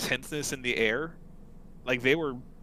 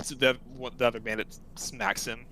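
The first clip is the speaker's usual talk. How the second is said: normal talking voice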